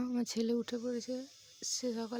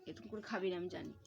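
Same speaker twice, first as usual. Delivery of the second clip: ordinary talk, nothing marked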